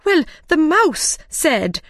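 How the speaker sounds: high, slow, protesting voice; pleading excuse. A narrator's reading. Mad Hatter blaming the Mouse, pleading.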